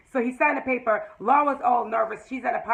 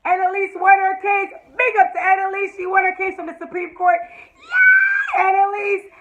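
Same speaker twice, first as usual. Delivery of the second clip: high, loud joyful shout of yay; excited cheer; 8 semitones higher, 6 dB louder than usual. Real, not acted. Celebrating Annalise's win to viewers, a loud excited cheer. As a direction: cheer for her like a fan. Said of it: "I was so excited".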